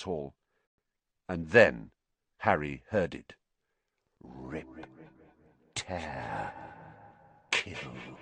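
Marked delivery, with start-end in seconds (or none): 4.2-8.2 much lower, very soft, slow menacing hiss of words; a monster in the walls; cold murderous voice. A narrator's reading. Unseen monster in the walls craves killing, cold and murderous. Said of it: "the same cold, murderous voice".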